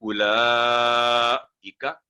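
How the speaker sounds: उलाइका drawn out with ला and इ kept apart; slow recitation. Shown as a demonstration. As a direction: recite slowly. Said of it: "connection नहीं जोड़ना".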